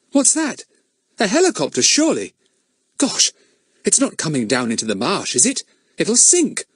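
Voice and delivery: put-on high, startled boy spotting a helicopter; Larry pointing up, pitched high; surprised boy. A narrator's reading. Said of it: "said Larry suddenly".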